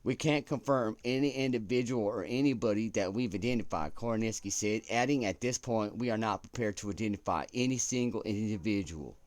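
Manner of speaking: hammed-up broadcast read; news anchor accent. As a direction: deliver it like a network anchor. Continues anchor-style news read.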